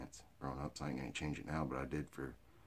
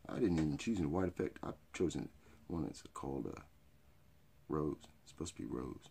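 usual speaking voice

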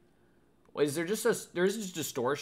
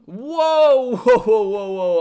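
surprised; 8 semitones higher, 13 dB louder, slower than usual. Real, not acted. Reacting to stream alert.

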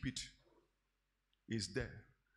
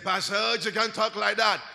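shouting; 4 semitones higher, 9 dB louder than usual. Retold or imitated. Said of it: "I said".

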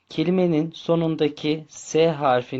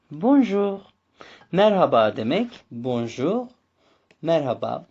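usual speaking voice

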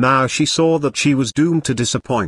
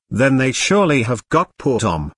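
ordinary delivery

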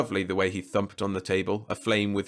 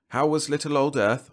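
awkward; 6 semitones higher, 4 dB louder than usual. A narrator's reading. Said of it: "I said awkwardly".

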